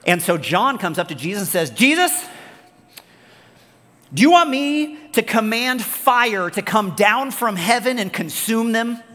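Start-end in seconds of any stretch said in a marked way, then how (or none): 1.7-9.0 high, loud, overeager offer, slowing down; eager disciple. Acted. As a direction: savor the idea of raining fire on them. John eagerly offering to call fire.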